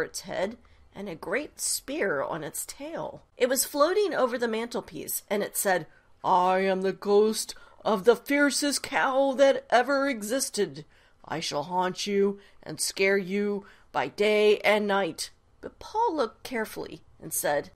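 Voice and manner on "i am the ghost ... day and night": loud, booming ghost threat; fierce ghost cow. Acted. Ghost cow menacing Paul with a booming haunting threat. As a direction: spook him like a fierce phantom cow.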